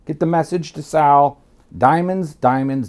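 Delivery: loud, insistent repetition of the key word; emphatic instruction. Retold or imitated. Retelling his orders before the drug meeting, emphatic instruction. Drilling a cover story into Sal.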